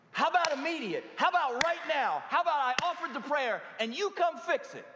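high, pressing, exasperated asking; pleading complaint to Jesus. Pretend. Believer complaining to Jesus about waiting, exasperated and pleading. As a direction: demand it now, like a frustrated believer. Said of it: "This is love, Jesus?".

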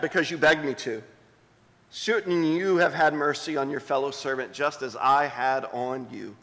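hushed reading of scripture. Acted. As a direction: read the parable gently to the congregation. Pastor reads the parable aloud.

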